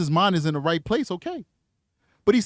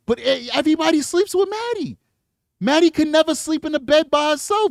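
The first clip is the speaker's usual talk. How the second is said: high disbelief, much higher in pitch; incredulous; 12 semitones higher, 4 dB louder than usual. Real, not acted. Commentator baffled by the sleeping arrangements, higher incredulous voice.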